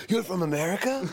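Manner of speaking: imitating sudden high-pitched disbelief; amazed excitement. Rock star learning the fan is from America, excited surprise. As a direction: act out a thrilled, incredulous question. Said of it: "And he got so excited".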